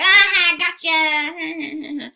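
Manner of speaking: high, loud, laughing crow of triumph; spring the prank with a cackle; gleeful gotcha. Retold or imitated. Prankster pupil catching his master, triumphant shout.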